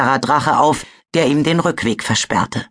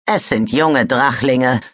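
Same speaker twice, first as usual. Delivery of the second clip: ordinary delivery, nothing marked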